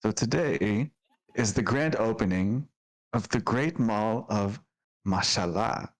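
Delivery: normal talking voice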